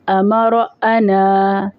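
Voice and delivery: امر، انا stretched out slowly and joined; slow linked reading. Shown as a demonstration. Teacher reviews the joined words with the children, slowly. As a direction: read امر انا slowly as one flow. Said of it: "سب کو ملا کے پڑھیں گے".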